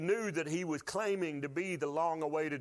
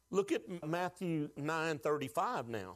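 normal talking voice

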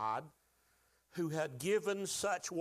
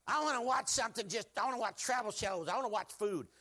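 higher whining craving voice; whiny flesh; 6 semitones higher than usual. Retold or imitated. Preacher acting his flesh begging for food shows, whiny. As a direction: whine like a kid wanting treats.

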